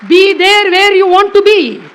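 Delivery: slow, high, loud motto; rousing declamation. Acted. Speaker urging the audience on, rousing declamation.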